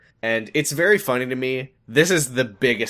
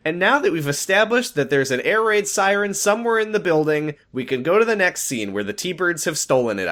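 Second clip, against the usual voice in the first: mock announcer; 7 semitones higher, 4 dB louder than usual. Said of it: "and then she says".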